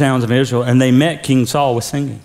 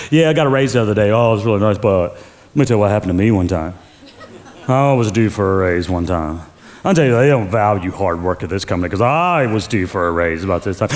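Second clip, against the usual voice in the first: lower, half-laughing mock boast; self-centered coworker; 8 semitones lower, faster than usual. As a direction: lightly play a self-absorbed coworker hijacking the news. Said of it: "you tell but me person".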